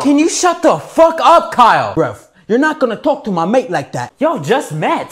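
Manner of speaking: angry yell. Real, not acted. Friend yells at Kyle.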